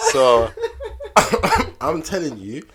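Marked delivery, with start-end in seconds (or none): 0.6-1.8 loud high laugh. Real, not acted. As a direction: burst out laughing. Laughing mid-story.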